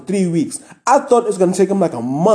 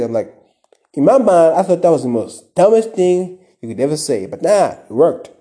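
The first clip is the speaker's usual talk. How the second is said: ordinary delivery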